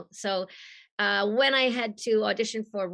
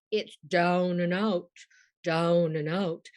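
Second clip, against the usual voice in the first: "down and out" slow and lower, in British vowels; 3 semitones lower, slower than usual. Shown as a demonstration. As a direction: correct "down and out" like a British elocution teacher. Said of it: "You know, very British".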